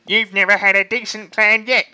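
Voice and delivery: scornful Bunce. Acted. Bunce mocking Bean's plan, loud and scornful. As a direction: sneer at him. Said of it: "said Bunce".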